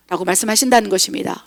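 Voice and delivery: ordinary delivery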